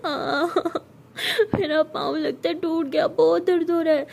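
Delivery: put-on a hurt girl whimpers to her friend in pain. A narrator's reading. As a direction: voice a girl hurting her foot. Sara hurt, voiced in pain.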